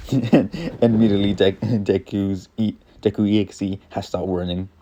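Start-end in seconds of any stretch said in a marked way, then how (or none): none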